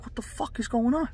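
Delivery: high, soft disbelief; acted bewilderment. Bewildered at crowd spilling out.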